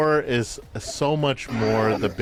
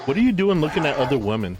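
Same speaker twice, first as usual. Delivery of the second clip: mock jealous; 5 semitones higher, 3 dB louder, much faster than usual.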